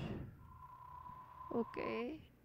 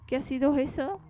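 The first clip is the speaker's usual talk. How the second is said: loud alarmed reading; 3 semitones higher, 8 dB louder than usual. Acted. Reads spooky game line aloud.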